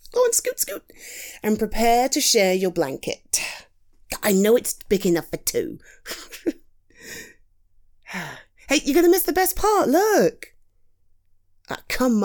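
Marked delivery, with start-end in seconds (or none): 0.1-0.8 playful shoo. Acted. Shooing friend over. 8.7-10.5 excited. Acted. Excited during movie.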